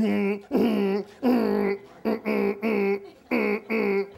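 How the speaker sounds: hummed "mm" buzzes like a vibrating phone; imitated phone buzz. Pastor mimics a phone vibrating during counseling, humming buzzes. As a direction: buzz like a phone on silent, short hums.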